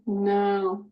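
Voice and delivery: ordinary talk, nothing marked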